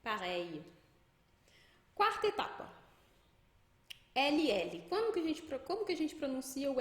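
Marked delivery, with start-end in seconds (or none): 0.0-0.6 "Pareille" with the final I glide held; I mais longo. Shown as a demonstration. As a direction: say "Pareille" carrying the I a little longer. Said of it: "um I um pouco mais longo". ("Pareille" em francês, com I final alongado)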